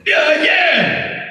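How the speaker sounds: high, stretched-out mimicking yell; high-pitched mock holler; imitated excited stranger. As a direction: holler agreement in a high put-on voice. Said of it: "she's like".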